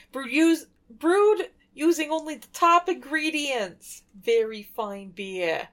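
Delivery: exaggerated New York accent reading the can; Brooklyn-style beer label read. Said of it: "You gotta say it with a New York accent".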